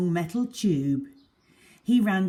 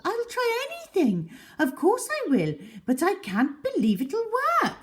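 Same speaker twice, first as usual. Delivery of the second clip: much higher woman's voice; high-pitched, eager neighbour voice; Mrs Silver's voice; 12 semitones higher than usual. Acted. Mrs Silver answers Mr Hoppy.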